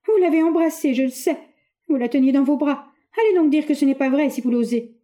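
high, loud accusing outburst; a jealous young woman daring her fiancé to deny it; jealous accusation. A narrator's reading. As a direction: throw the charge in his face.

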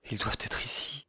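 hushed, near-whispered murmur; murmured under breath. A narrator's reading. Friend to himself on the wreck, breathy murmur. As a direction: let it out softly, barely above a breath. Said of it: "murmurer dans un souffle".

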